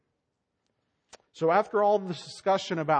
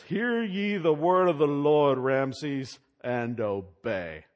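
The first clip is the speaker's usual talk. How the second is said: slow, louder commanding prophet voice; Moses proclaiming; 6 dB louder, much slower than usual. Retold or imitated. Moses proclaiming to Pharaoh, slow and commanding. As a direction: boom it out like Moses before Pharaoh. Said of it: "Moses goes up to Ramesses and says".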